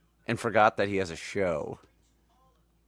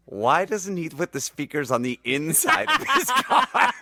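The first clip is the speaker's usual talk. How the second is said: laughing; 6 semitones higher than usual. Retold or imitated. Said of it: "one of the alien guys goes".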